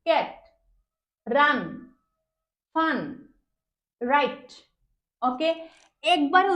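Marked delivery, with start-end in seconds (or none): 0.1-4.5 each word a single unbroken beat, long pauses between; one beat each. Shown as a demonstration. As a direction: say "cat, রান, ফান, right" as isolated single syllables. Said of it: "একবার উচ্চারণ করেছি". (প্রতিটি শব্দ এক ঝোঁকে, আলাদা করে)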